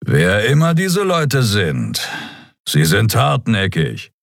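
slow, drawn-out grudging respect; almost appreciative. A narrator's reading. Tired captain on his pursuers, almost appreciative. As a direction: stretch the words in measured appreciation. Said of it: "Fast anerkennend sagte er".

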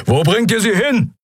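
high, strained roar. A narrator's reading. Keru to the guards taking Endriel, roaring. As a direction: roar the question from a cage. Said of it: "brüllte Keru".

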